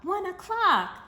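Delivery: very high, loud exclaim; excited exclaim. Acted. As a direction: sing out the answer. Men reply emphatically.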